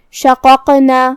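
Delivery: slow شققنا, bouncing the second ق; qalqalah on شققنا. Shown as a demonstration. Teacher showing qalqalah, bouncing the ق in شققنا. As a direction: chant شققنا with heavy ق, the silent one echoed. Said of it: "ہمیں اسے ہلاتے ہوئے".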